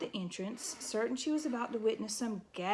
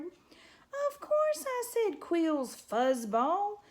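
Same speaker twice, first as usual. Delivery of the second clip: much higher, slow and sneering; mocking porcupine; 10 semitones higher, 4 dB louder, much slower than usual. A narrator's reading. Porcupine taunting the scared mouse, sneering. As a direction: drag it out to taunt her.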